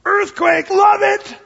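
high, loud, whooping shout; hammed-up excitement. Preacher playing Elijah thrilled by the earthquake, hammed-up excitement. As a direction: cheer the disaster on like a thrilled fan. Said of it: "He's all excited".

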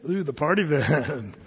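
slow, low knowing tease; teasing dad. Acted. Dad teasing his teen over the minivan keys, slow and low. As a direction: drag it out like you caught them.

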